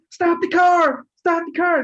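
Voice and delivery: high-pitched mock cry of alarm; urgent plea, imitated. Trapped passenger begging the driver, imitated urgent cry. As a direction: imitate a desperate passenger calling out.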